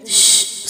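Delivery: soft, breath-only shush; hushing shh. Acted. Steward silencing two labourers over a secret, soft shush. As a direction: hush them with a finger to the lips. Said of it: "ചുണ്ടിൽ വിരൽ വെച്ച്".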